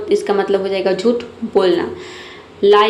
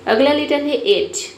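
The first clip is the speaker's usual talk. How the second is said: ordinary talk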